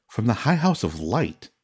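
ordinary delivery, nothing marked

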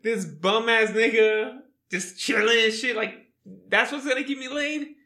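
high, laughing mock disbelief; incredulous. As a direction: scoff in amazement through a laugh. Retold disbelief at her delighted reaction.

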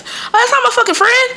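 high-pitched indignant denial; mock protest. Daughter denying to mom the girl's a friend, mock indignant. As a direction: push the pitch way up in comic protest.

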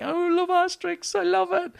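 very high, put-on fan voice; gushing fan. Fan pleading love to doubting park staff, gushing. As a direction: gush in a squeaky mock-fan pitch. Acted reply of an Asterix fan.

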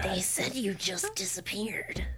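breathy whispered line. Acted. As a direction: whisper like a serpent woman. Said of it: "that's [name]'s voice".

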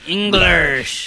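hammed-up West Country English r; Southwestern English accent. Friend echoing the accent's name, in Southwestern English. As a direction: overdo the Southwestern English burr. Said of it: "Southwestern English".

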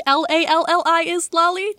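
LALLI spelled out high, then said as "lolly"; tentative pronunciation. Shown as a demonstration. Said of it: "That seems right to me".

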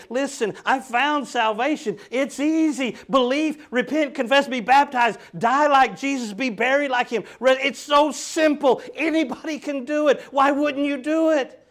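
high, loud and eager, bursting with news; overjoyed retelling of telling family about salvation; excited new convert. Retold or imitated. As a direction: gush it out like you just found the answer. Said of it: "I went home excited to tell friends and family".

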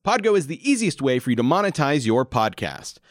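upbeat sponsor read. Acted. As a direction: read it like a podcast ad.

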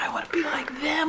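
soft whispered aside; whispering. Real, not acted.

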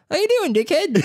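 high, put-on Australian greeting; mock Aussie greeting. Friend imagines landing in Australia, hammed-up high Australian greeting. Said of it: "someone go".

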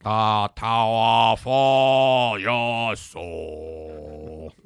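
very slow, drawn-out dim giant voice; Andre the Giant. Retold or imitated. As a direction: drag each word out like a slow giant. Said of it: "That's my Andre the Giant impression".

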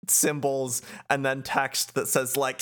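ordinary talk

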